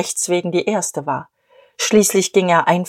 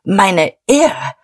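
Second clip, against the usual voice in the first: a touch louder, sharp outburst; snapping; 4 dB louder than usual. Acted. Diederich to Emmy, a brief louder snap of outrage. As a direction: snap it out, then stop. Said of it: "Er schnappte".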